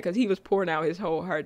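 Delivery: ordinary delivery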